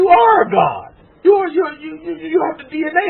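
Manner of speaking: high, loud cry of praise; fervent preacher's shout, pitched high; preaching shout. Real, not acted. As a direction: shout it out to the congregation with full force. Preacher peaks, shouting to congregation.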